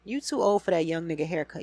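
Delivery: ordinary talk, nothing marked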